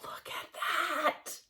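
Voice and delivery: slow, choked-up whisper; tearful whisper. Real, not acted. Showing listeners the gift fabric, a slow tearful whisper. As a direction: barely get the words out, on the edge of tears.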